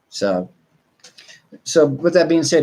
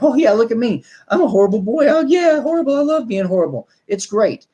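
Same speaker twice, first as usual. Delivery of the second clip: much higher, gleeful proud dog; high silly voice of a happy dog; cheeky dog voice; 9 semitones higher than usual. Retold or imitated. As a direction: play the dog delighted to be bad. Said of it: "he's gonna be like".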